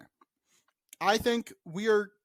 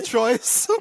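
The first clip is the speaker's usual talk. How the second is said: ordinary talk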